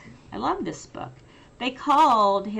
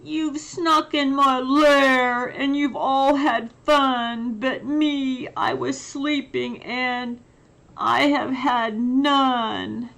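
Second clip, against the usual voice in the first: higher, louder hurt complaint; whining bear; 5 semitones higher, 4 dB louder than usual. Acted. Left-out bear complains to the guests who woke him, loud and whiny. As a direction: sulk at the partygoers like a left-out bear.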